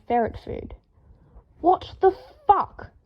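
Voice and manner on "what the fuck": very slow, high disgusted yell; very slow, high appalled yell. A narrator's reading. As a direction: recoil from the filthy shirt. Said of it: "Draco yelled".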